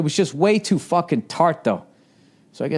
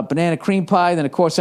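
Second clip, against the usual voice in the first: ordinary talk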